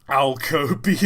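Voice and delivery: laughing through the words; real laughter. Real, not acted. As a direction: giggle over the pun. Laughing at own joke.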